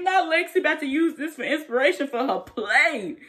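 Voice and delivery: high, loud, through laughter; laughing. Real, not acted. Vlogger laughing about Lexi using the fight for her play. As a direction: crack up while joking.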